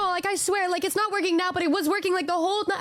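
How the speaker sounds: high, insistent retelling of her plea; pleading insistence. Retold or imitated. Friend swears to doubters the squeak was working, imitated insistence. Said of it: "she was like".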